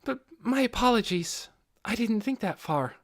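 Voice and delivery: maid character voice. A narrator's reading. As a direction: apologize as the dutiful servant. Servant apologizes to master.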